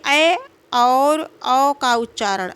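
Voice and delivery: "ऐ और औ" drawn out slowly, each vowel held long; slow vowel demo. Shown as a demonstration. Teacher introducing the ऐ and औ vowels, slow and careful. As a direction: stretch "ऐ" and "औ" so students hear each vowel. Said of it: "ऐ का उच्चारण कंठ और तालु से होता है".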